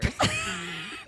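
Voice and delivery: a burst of high laughter. Real, not acted. Laughing at running joke.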